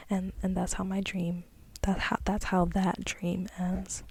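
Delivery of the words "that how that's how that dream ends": ordinary talk, nothing marked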